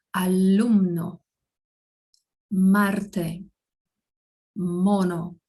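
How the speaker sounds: "alumno", "Marte", "mono" one at a time, carefully; Spanish M examples. Shown as a demonstration. Teacher giving learners M examples, careful isolated Spanish words. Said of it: "tem o mesmo som que em português".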